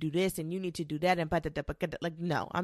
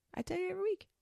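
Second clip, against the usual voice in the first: much higher and softer, playful; high sing-song repeat; 12 semitones higher, 6 dB quieter, much faster than usual. Shown as a demonstration. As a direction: repeat it in a teasing little high voice.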